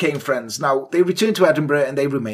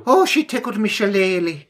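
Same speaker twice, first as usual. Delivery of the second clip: high mock Irish voice; Irish lover; 6 semitones higher than usual. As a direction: ham up a cheeky Irishman's accent. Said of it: "'cause I'm Irish".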